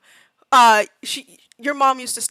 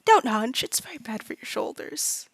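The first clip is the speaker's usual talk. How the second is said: soft breathy whisper; whispered advice; 5 dB quieter than usual. Acted. Woman to Baxter about hunching, soft whisper. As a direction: whisper the scolding kindly. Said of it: "you see the woman go".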